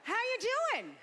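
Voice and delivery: high-pitched mock mother's greeting; botoxed mother. Mother greets her daughter at a wedding, mock botoxed mom. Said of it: "she was like".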